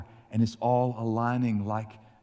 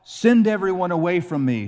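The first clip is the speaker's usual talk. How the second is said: higher and louder, urgent command; crying out; 7 semitones higher, 6 dB louder than usual. A narrator's reading. Joseph, overcome, cries out to clear the room. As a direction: cry it out, barely holding together. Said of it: "And he cried out".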